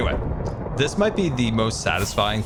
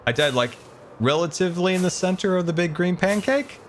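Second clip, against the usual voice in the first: ordinary delivery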